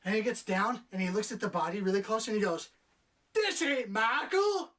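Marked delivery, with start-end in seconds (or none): 3.3-4.7 high, drawn-out, louder mimicked exclamation; mock cop outcry. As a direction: stretch it out in a hammed-up high yell. Mimics cop's absurd movie line.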